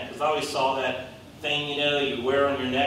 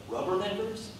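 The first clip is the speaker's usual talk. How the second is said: high, soft questioning "Rubberneckers?"; baffled by the word; puzzled; 6 semitones higher, 7 dB quieter than usual. Retold or imitated. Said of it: "we're like".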